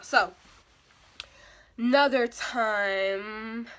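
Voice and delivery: ordinary talk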